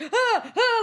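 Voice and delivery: frightened whimpers. Retold or imitated. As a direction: imitate a man edging back in fright. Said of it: "So then he's just like".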